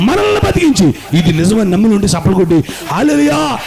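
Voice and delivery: rising, loud call for hallelujah; preaching call. Acted. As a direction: lead the crowd into a shouted hallelujah. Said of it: "అనండి". (ఉత్సాహంగా బోధించే పిలుపు)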